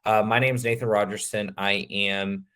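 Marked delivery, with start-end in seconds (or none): none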